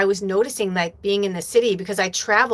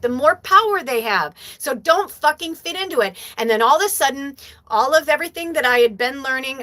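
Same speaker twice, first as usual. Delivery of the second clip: normal talking voice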